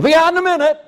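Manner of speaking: high, loud call from behind a door; god calling out. Acted. Preacher voicing Baal calling from the toilet, high loud call. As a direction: shout it out high, like someone stuck in the toilet.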